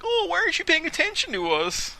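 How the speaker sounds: high, whiny falsetto complaint; high, whiny mock Team Rocket. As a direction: whine like an ignored villain. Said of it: "I'm like".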